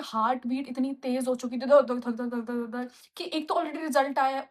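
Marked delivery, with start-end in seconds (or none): 1.7-2.9 thumping heartbeat sounds, first loud then dropping; heartbeat imitation. Telling how her heart raced, mock heartbeat. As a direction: mimic a pounding heart.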